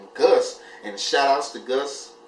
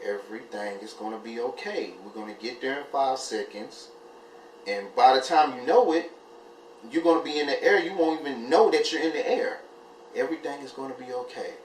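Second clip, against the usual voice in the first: soft, quiet, soothing reassurance; calm inner voice; 4 dB quieter than usual. Retold or imitated. A calming voice inside a nervous flyer's head, soft and soothing. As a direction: lull her nerves like a gentle voice in her head. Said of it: "that voice came in my head again telling me".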